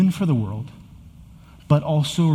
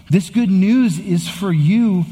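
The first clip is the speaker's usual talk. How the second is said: preaching peak; 7 semitones higher, 5 dB louder, slower than usual. Acted. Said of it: "refrain peak, then plain recap".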